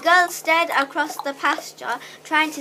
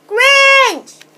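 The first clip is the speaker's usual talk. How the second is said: very high, loud alarm call; full-voiced shout; 11 semitones higher, 11 dB louder than usual. A narrator's reading. Bored girl calling down to village, shouting. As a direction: holler it long across the valley. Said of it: "she shouted".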